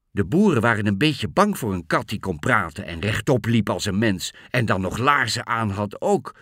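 usual speaking voice

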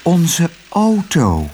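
slow, high, blissful exclamation; dreamy happy sigh; overjoyed sigh. A narrator's reading. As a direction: draw it out with delighted relief. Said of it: "zuchtte Otje dolgelukkig".

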